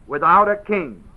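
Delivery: "Without" leaned on, falling at the end; "Without" stressed, the rest light. Shown as a demonstration. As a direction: lean on "Without" then let it drop. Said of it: "That's how it's said".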